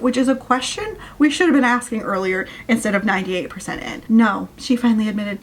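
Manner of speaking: normal talking voice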